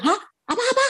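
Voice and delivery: very high startled yelp; retold high-pitched double take; stunned disbelief. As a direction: act out being frozen in shock. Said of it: "ndak langsung ketenggengan gini".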